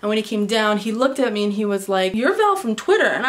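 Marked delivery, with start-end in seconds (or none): 2.2-3.1 higher-pitched, imitated delighted recognition; excited recognition. DJ recognizing a fan from Twitter, excited. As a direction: act out spotting a familiar face.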